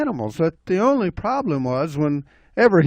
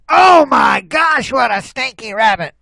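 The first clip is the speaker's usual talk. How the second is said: loud, high outburst; disgusted shout; 9 semitones higher, 5 dB louder than usual. Acted. Bobcat smelling the rabbit, loud high disgust.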